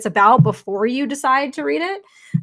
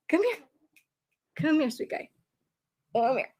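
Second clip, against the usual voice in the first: 1 soft coaxing; 4 semitones higher, 10 dB quieter than usual. Real, not acted. Softly coaxing the puppy.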